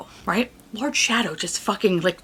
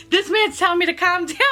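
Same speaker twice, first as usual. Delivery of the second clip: higher, disbelieving remark; incredulous aside; 8 semitones higher than usual. Retold or imitated. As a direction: say it like you can't believe him. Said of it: "I was just like".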